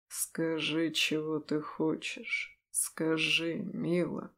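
low, soft whisper right in the ear; hot whisper in ear. Acted. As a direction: breathe it close into her ear. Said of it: "горячо шепчет в ухо".

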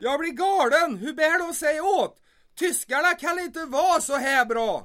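exasperated outburst. Acted. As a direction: throw up your hands at the Germans. Frustrated at the Germans' success.

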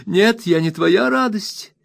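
very high hurt protest; very high offended wife's retort. A narrator's reading. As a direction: fling it back up high, offended. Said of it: "заявила Дора".

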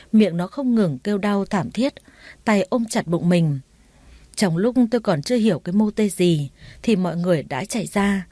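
usual speaking voice

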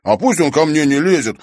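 loud, high, heated retort; hot-headed protest. A narrator's reading. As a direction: snap back, all worked up. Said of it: "горячился Димка".

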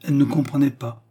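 usual speaking voice

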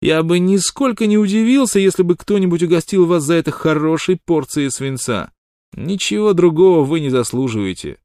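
high, loud mock outrage; hotly indignant. A narrator's reading. Shorty feigns offended virtue at Wild Water, hot indignation. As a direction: play wounded virtue to the hilt. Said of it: "горячо продолжал малыш".

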